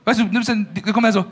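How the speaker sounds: ordinary talk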